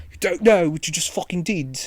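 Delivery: imitating the train mugger, London accent; mock London thug. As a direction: do the angry London geezer. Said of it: "you gotta say it like he did".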